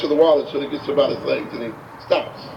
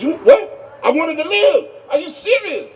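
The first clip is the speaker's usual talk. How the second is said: very high-pitched incredulous outrage; hammed-up shrieking disbelief; going off again; 12 semitones higher than usual. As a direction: rant frantically at the man who dunked you. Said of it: "he goes off again".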